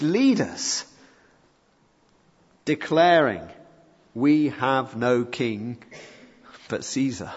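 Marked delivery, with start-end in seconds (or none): 4.1-7.3 slow damning quote. Acted. Leaders' declaration set against prayer.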